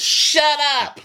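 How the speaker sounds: high-pitched mock yell; hammed-up squawked retort; Erica. Erica cutting off the quarrel, high mock yell. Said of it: "And Erica goes".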